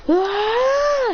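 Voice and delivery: high, alarmed "ah"; group scream. Acted. Team screaming as they lose altitude, frightened. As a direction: shriek together in fright.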